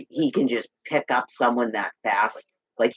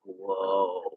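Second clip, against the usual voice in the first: soft whoa; 5 semitones lower, 6 dB quieter than usual. Retold or imitated. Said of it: "almost like fear".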